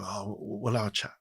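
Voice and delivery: a hint of Liverpool in a quiet aside; lightly imitated Liverpudlian accent. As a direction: mimic a friendly Liverpudlian TV boss, understated. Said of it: "sorry about the accent".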